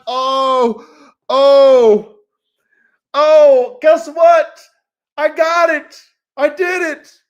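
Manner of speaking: loud, high cry of victory; triumphant shout. Pretend. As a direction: yell it like you just won it all. Imagined triumph, loud outburst.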